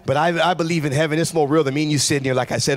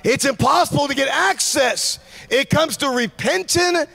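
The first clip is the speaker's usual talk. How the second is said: fiery preaching; 7 semitones higher, slower than usual. Acted. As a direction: preach it hard to the crowd. Preaching at full force.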